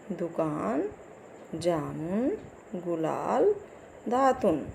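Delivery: each word read alone with a rising end; word drill. Shown as a demonstration. Teacher reading a word list aloud to pupils, drill style. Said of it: "आप लोग और देख लीजिए".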